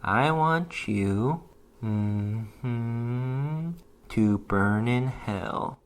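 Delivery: drawn out, very slow and low; slow menace. A narrator's reading. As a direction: savour each word as the trap springs. Slow menacing reveal at her ear.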